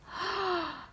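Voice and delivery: sharp intake of breath in wonder; amazed gasp. A narrator's reading. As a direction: gasp at a magnificent sight. Amazement at sight of castle.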